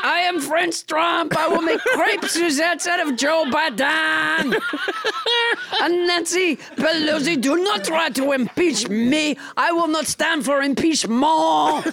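blustering mock-French proclamation; French Trump. French Trump threatens Biden and Pelosi, blustering French accent. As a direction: declaim it with absurd French vowels. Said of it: "your extremely realistic accent".

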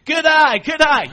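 high, loud dugout call; coach cheering. Retold or imitated. Little League coach calling out to his batter, high and loud. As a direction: shout encouragement to the batter.

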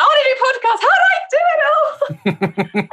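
high pitched eager squeal; shrill and high. Retold or imitated. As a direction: squeak it out like an excited beginner. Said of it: "And then go really shrill and high pitched".

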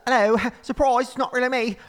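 high-pitched, hammed-up New Zealand accent; bad New Zealand accent. YouTuber revealing herself on Jay Leno, bad New Zealand accent. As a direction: put on a shaky attempt at New Zealand. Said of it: "It's supposed to be New Zealand".

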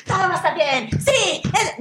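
high, sing-song fake cheer; mock toxic positivity. Mocking friends who dismiss anxiety, fake chirpy. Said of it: "ese positivismo tóxico".

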